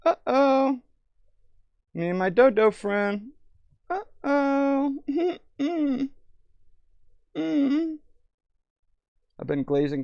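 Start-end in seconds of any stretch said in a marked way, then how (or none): 0.1-7.9 silly high voice. Pretend. Playful silly reaction voice.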